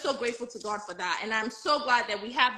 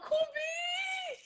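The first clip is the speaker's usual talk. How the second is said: calling out; 6 dB quieter than usual. Real, not acted. Calling friend from across room.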